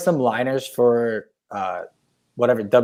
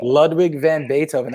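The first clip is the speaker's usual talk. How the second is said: ordinary delivery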